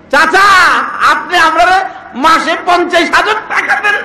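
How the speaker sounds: put-on high, loud child's wail; pleading little boy. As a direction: beg like a boy at the postman's feet. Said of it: "ছোট্ট পোলা গিয়া পিয়নের পায়ে ধরছে".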